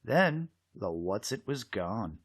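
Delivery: ordinary delivery, nothing marked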